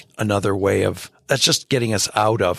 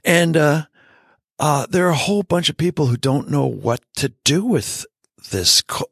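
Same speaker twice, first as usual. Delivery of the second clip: normal talking voice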